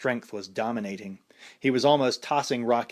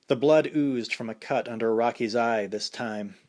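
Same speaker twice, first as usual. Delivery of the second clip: usual speaking voice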